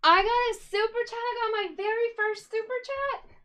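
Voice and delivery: high, loud burst of delight; excited squeal. Real, not acted. Streamer thrilled by her first super chat, squealing.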